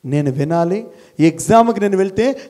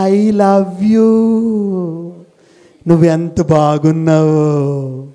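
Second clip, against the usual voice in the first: mock sweet talk; 2 semitones lower, much slower than usual. Mocking old-age sweet talk.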